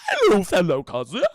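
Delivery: a spooky imposter greeting; creepy fake voice. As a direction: sound like someone pretending to be Beth. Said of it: "it doesn't sound like it sounds like".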